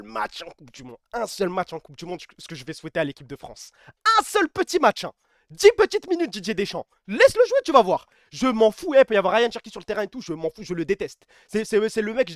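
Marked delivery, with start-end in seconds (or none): 4.2-8.1 high, loud plea to the coach; excited plea. Real, not acted. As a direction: beg the coach, voice climbing. Pleading with the coach.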